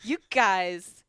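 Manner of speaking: loud, hammed-up comic's bit in a put-on voice; Paul Gregory impression. Comedian parodying another comic's joke for co-hosts, mock-exasperated. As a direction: belt out a mock stage complaint in someone else's voice. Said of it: "here's me doing a Paul Gregory joke".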